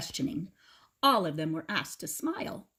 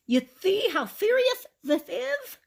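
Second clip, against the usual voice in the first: toothless Grandpa; 7 dB louder than usual. A narrator's reading. Grandpa mourns his stolen teeth, high toothless lisp. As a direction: lisp through a mouth with no teeth. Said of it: "moaned Grandpa".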